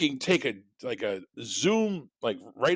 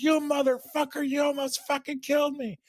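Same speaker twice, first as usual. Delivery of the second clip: imitating screaming old lady. Old lady screaming at the reckless driver, mock shriek. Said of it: "old lady gets out of her car and she's like".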